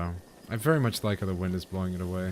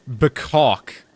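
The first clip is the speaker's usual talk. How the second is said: loud squawked chicken call; chicken cluck; 4 semitones higher, 8 dB louder than usual. Pretend. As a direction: squawk it like a hen. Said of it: "That was my chicken impression".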